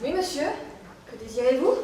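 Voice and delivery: low, soft, deferential reply; hushed politeness to an official; meek answer. Acted. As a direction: answer authority cautiously and quietly. Answers a bailiff's summons.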